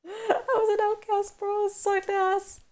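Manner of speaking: high, whiny fake sob; mock crying. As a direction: wail it like a melodramatic victim. Jokey self-pity after "racial enigma.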